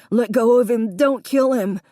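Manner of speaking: loud, high, panicked shout; frantic shout. A narrator's reading. Davy screaming at Connor to spare the puppy, frantic. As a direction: yell it in fear for the dog.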